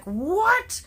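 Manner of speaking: high squeaky disbelieving "What?"; a wife's stunned, high-pitched question; shocked what. Retold or imitated. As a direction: jump way up in disbelief. Wife shocked at three-hour wait.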